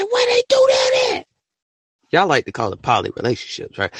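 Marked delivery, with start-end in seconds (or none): 0.0-1.3 shrieking disbelief. Retold or imitated. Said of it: "I said".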